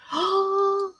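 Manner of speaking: high, sharp gasp of delight. Real, not acted. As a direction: gasp as the cute gift appears. Sees Crafty's parcel.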